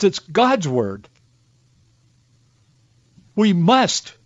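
emotional. Real, not acted. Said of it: "Sorry I get so emotional about".

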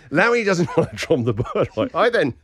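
ordinary talk, nothing marked

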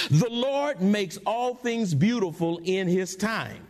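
ordinary talk, nothing marked

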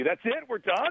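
playful high disbelieving questions; mock surprise. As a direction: act shocked that the interview is already over. Interview suddenly ending, mock disbelief.

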